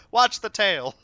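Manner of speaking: loud, high warning shout; mock shouted warning. Raid leader warning about the tail, loud shout. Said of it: "He just".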